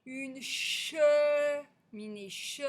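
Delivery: CHE held long each time; elongated vowel. Shown as a demonstration. Teacher shows the first syllable, "che" sustained. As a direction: draw out the e sound in "che". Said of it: "c'est bien le son e".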